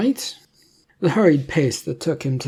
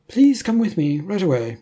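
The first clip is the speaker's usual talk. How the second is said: higher, brisk official voice of a government man; a slick agent pressing his offer, pitched up; 6 semitones higher, 5 dB louder than usual. A narrator's reading. Agent urges Leonardo.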